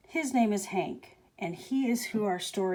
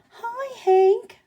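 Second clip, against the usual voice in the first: high, loud, bright greeting; cheery call; 9 dB louder than usual. Acted. Tumbleweed calling out to the cactus, high cheery hello. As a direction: sing out a friendly hello. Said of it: "Rosie the tumbleweed called out".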